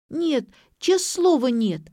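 put-on high little-girl denial; earnest, high-pitched kid sister; child's treble. A narrator's reading. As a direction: protest innocence in a high child's voice. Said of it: "дисконт Леры".

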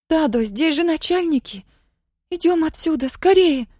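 put-on high, urgent scared child's voice; frightened girl. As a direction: pull him away in panic. Frightened girl urging father away.

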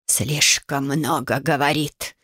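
low, slow grating rasp; grating voice behind you. A narrator's reading. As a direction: grind it out from behind. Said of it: "проскрежетал голос за спиной".

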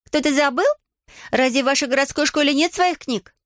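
high, needled questioning; stung. A narrator's reading. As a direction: question her as if offended by the remark. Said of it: "неожиданно задетая словами Алианы".

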